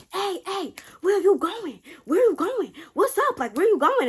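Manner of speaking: high-pitched mock calling out; man calling after her. Man comes out of the store as she drives away, high mock calling.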